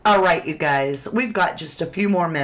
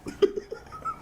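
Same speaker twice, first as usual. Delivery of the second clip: soft giggle; 7 dB quieter than usual. Real, not acted. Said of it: "I had the giggles".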